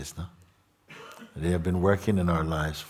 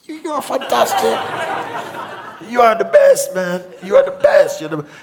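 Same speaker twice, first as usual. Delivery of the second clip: much higher and louder, laughing praise; gushing excitement; 11 dB louder than usual. Retold or imitated. Teacher dodges the question with loud, high, laughing flattery. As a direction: burst out with giddy admiration. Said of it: "I said".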